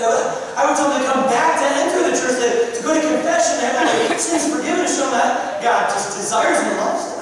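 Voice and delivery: urging her to come back to church; earnest insistence. Retold or imitated.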